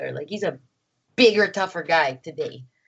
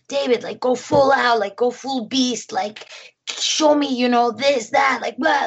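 imitating an intense director, higher-pitched; fired-up directing; 4 semitones higher than usual. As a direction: urge the actor on, all in. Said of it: "like, going so into it".

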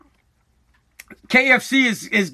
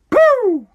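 sudden shout; 13 semitones higher, 4 dB louder than usual. Real, not acted. Sudden shout after rant.

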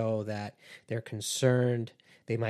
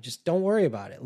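higher, louder and fast; exasperated dismissal; 6 semitones higher, 6 dB louder, faster than usual. Retold or imitated. Manipulator losing patience with the complainer, fast and loud. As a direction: snap it off impatiently.

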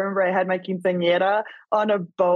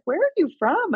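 curious local; 12 semitones higher than usual. Retold or imitated. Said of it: "people are always like".

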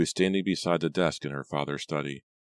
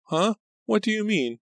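very high, alarmed demand; thin high voice pressing for an answer; 5 dB louder than usual. A narrator's reading. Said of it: "Pam demanded".

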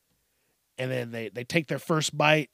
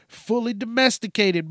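ordinary talk, nothing marked

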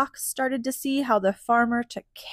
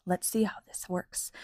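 hushed aside; whispering; 2 semitones lower, 6 dB quieter than usual. Real, not acted. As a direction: whisper it to yourself. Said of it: "unvoiced whisper".

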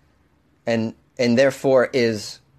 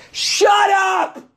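shouting; 3 dB louder than usual. Pretend. Mock outburst at himself.

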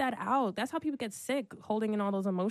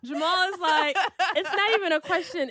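giggling through the words, higher and louder; laughing; 10 semitones higher, 7 dB louder than usual. Real, not acted. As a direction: keep laughing as you explain. Still laughing about Jamal.

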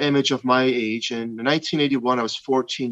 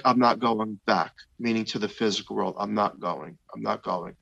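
quiet refusal; 4 semitones lower, 7 dB quieter than usual. Retold or imitated. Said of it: "I'm like".

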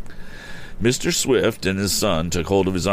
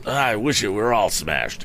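higher voice, mildly vehement; vigorous exclamation; 4 semitones higher than usual. A narrator's reading. Injured rider disowns his motorcycle, mildly vigorous. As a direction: put some force into a disgusted wish. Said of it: "exclaimed the man vigorously".

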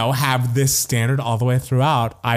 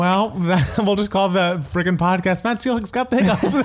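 laughing; 9 semitones higher than usual. Real, not acted. Joking podcast title, laughing.

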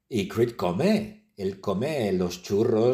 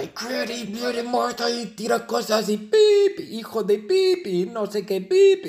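chaotic outburst; 10 semitones higher than usual. Acted. Narrator describing Martha throwing things, high excited bleeps. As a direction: rattle off the chaos.

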